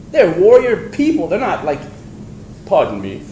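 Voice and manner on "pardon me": low, prim British "Pardon me"; mock genteel English gentleman; a hammed-up posh British plea; posh English accent. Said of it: "I can't do that accent for long".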